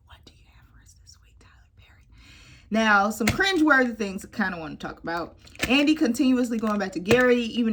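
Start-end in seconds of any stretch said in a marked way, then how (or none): 0.1-2.0 fast whispered repeat. Pretend. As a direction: hush it right down. Same question, whispered after shout.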